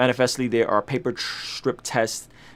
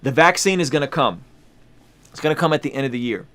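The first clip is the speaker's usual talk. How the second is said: ordinary delivery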